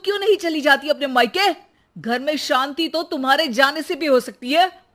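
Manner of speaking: husband yelling at wife in fury; furious. Acted. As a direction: tell her to shut up in a rage. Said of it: "गुस्से से आग बबूला हो जाता".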